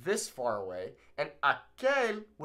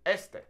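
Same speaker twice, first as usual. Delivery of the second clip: "Este" with a higher, stressed first syllable, ES-te; 4 semitones higher than usual. Shown as a demonstration. Teacher modelling the word after explaining, "Este" stressed on first E. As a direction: hit the opening E of "Este". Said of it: "the emphasis falls on that first E".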